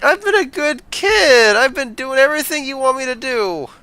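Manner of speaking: pleading whiny informant. Acted. Herbert pleading, higher pitch.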